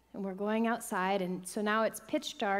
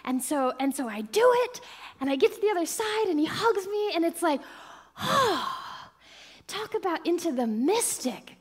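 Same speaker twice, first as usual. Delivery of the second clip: high, loud and fast euphoria; ecstatic rush; 7 semitones higher, 5 dB louder than usual. Real, not acted. Reaching the other side, loud ecstatic joy. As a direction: burst with joy. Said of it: "zero to a hundred".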